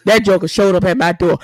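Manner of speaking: ordinary delivery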